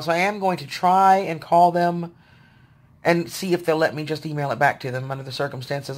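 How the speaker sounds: usual speaking voice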